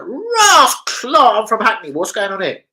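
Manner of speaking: high, loud mock yell; retold yell. Eleven-year-old facing a knife, hammed-up high yell. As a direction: yell back like a cocky kid. Retelling his reply at knifepoint.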